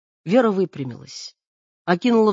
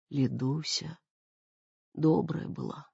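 weak dying voice; 3 semitones lower, 10 dB quieter than usual. Acted. Dying mother speaks weakly.

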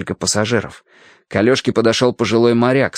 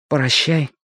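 put-on subdued boy's goodbye, only slightly raised; reluctant farewell; 4 semitones higher than usual. Alyoshka has to leave his new friend, reluctant farewell. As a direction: say goodbye unwillingly.

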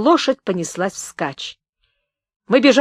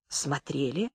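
very soft, low reply, lifting at the end; meek answer; 6 semitones lower, 12 dB quieter, slower than usual. A narrator's reading. Journalist answering the shouting gunman, meek and hushed. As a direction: answer almost inaudibly, cowed.

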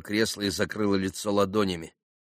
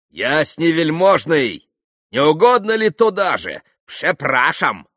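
exclaimed invitation; 7 semitones higher, 6 dB louder than usual. A narrator's reading. Mitya shows the little pan the door, exclaiming. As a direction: call out with exaggerated Polish courtesy. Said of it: "воскликнул к маленькому пану".

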